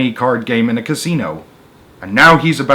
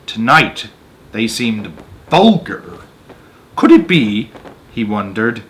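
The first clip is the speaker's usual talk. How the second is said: measured read-aloud narrator voice; story narration; 3 semitones higher, 3 dB louder than usual. A narrator's reading. Reading a Roald Dahl story to an audience, storyteller voice. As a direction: tell the tale steadily to listeners.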